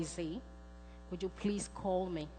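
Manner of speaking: ordinary talk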